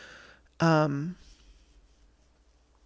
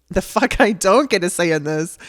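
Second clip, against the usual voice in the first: loud, furious shout thrown back; angry yell; 3 semitones higher, 9 dB louder than usual. Retold or imitated. Yelling back at husband over the feeding tube, furious. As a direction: yell it back at him, outraged. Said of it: "I yelled back".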